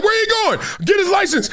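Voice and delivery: high, shouted demand; yelling. Retold or imitated. The guy yells after the car for its plate. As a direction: yell after the fleeing car. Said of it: "He's like".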